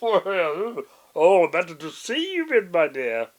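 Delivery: wolf faking a high, loud granny voice, hesitant; disguised voice. A narrator's reading. Wolf pretending to be grandma, clumsy disguised voice. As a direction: clear throat and fake an old lady badly.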